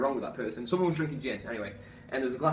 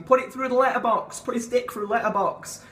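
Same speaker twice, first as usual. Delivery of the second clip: high, loud rowdy chant; crowd shouting; 9 semitones higher, 5 dB louder than usual. Retold or imitated. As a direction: yell it like a drunk crowd. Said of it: "people who were shouting".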